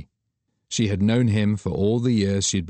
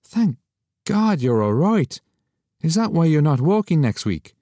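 higher, louder relieved friend; Douglas relieved; 3 semitones higher, 3 dB louder than usual. Acted. Friend relieved she survived, higher voice. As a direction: sigh out relief then invite.

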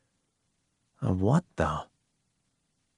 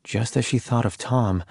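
ordinary delivery

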